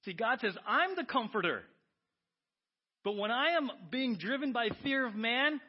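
high, loud, driving preacher voice; fiery preaching. Acted. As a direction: preach it hard to the room. Preaching at full force.

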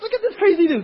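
high, loud excited jeer; hammed-up laughing disbelief; mock amazed onlooker. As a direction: shout it like someone sharing a crazy video. Acted reaction to viral video.

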